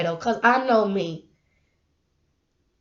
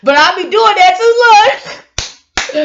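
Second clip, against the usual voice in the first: slow, high laughing confession; 8 semitones higher, 8 dB louder, much slower than usual. Real, not acted. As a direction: laugh through the admission. Laughing admission.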